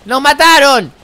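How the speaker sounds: usual speaking voice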